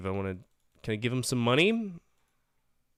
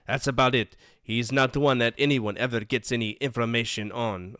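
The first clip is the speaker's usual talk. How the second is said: loud, higher sleazy photographer; brash greasy photographer voice; 4 semitones higher, 6 dB louder than usual. Acted. Voices photographer reading text.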